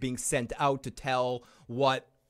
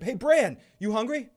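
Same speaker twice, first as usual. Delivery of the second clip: cheerful caller; 8 semitones higher, 5 dB louder than usual. Retold or imitated. Voicing someone offering Bran food.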